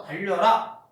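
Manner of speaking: loud, sharp order; command. Shown as a demonstration. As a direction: call out the command. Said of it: "라고 끝나는 거". (크게 외치는 명령)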